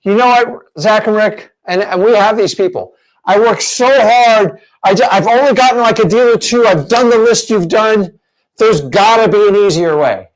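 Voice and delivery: mock struggling student. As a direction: plead like a worn-out newbie wanting a shortcut. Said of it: "A lot of you go".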